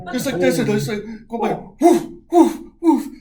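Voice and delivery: loud, high whooshing woofs; whoosh of passing train. Retold or imitated. Storyteller mimics a passing train, loud whooshes. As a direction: make the train blast past you.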